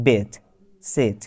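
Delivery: বেট and সেট with a short vowel between e and i; short e demo. Shown as a demonstration. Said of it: "ইংরেজির প্রথম short ই সাউন্ডটা হচ্ছে".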